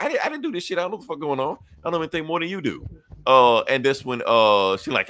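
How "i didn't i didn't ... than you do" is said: high, frantic protest; panicked denial. Acted. Luthor insisting he didn't do it, high and panicky. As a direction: squeak out your innocence, high and rattled.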